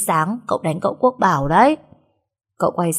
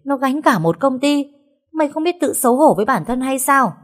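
furious father; 5 semitones higher than usual. Acted. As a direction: berate the eldest son. (người cha quát mắng con)

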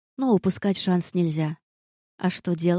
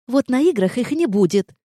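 louder, higher defiant retort; defiant shout; 5 semitones higher, 5 dB louder than usual. A narrator's reading. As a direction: fling it back defiantly. Defiant retort before running off.

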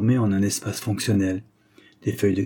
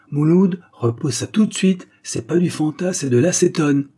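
sharp, louder, higher warning; alarmed order; 7 semitones higher, 5 dB louder than usual. A narrator's reading. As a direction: stop him right now with an urgent command. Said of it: "Victor, alerté".

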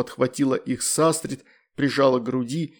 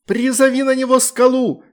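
much higher and louder yell; shouting girl; 11 semitones higher, 6 dB louder than usual. A narrator's reading. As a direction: shout the order in battle. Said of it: "крикнула девочка".

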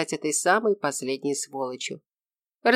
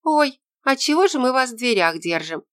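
put-on higher-pitched girlish exclamation; sudden high "oh!" of realisation; exclaiming; 7 semitones higher, 3 dB louder than usual. A narrator's reading. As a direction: exclaim like a girl who just caught herself. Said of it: "воскликнула Тося".

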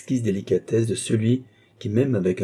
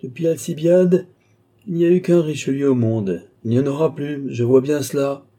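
high, loud lament; old marshal crying out; exclaiming old marshal; 5 semitones higher, 4 dB louder than usual. A narrator's reading. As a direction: proclaim it loudly, pitched up. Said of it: "s'écria le vieux maréchal".

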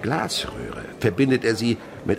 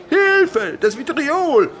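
high, loud scream of alarm; panicked cry; 8 dB louder, much slower than usual. Acted. A pupil screaming for help after the acid splashes. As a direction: cry out in terror.

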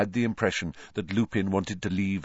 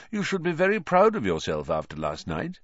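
ordinary delivery

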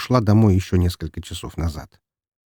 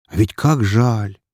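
ordinary delivery, nothing marked

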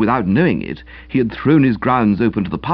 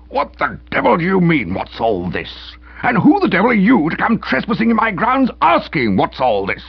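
angry uncle; 9 semitones higher than usual. Acted. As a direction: explode at the policeman. Uncle explodes at policeman.

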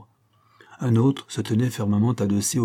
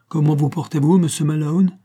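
higher, louder greeting; cheerful calling up; 6 semitones higher, 5 dB louder than usual. A narrator's reading. Shirley greeting Malone up in the gallery, cheerfully calling.

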